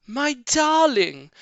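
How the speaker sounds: high, slow, loving cry; wife greeting her returning husband, joyful high cry. Acted. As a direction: call out to him in relief. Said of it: "cried Missus Fox".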